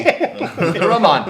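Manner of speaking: put-on slow laughing Jamaican accent. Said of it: "That's my Jamaican accent".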